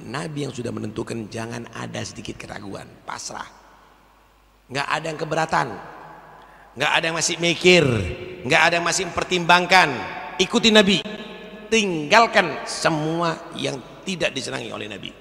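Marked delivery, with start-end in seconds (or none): 4.7-15.1 louder and higher, driving each line harder; preacher building to a loud, high climax; fiery preaching. Acted. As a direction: hammer it home to the congregation.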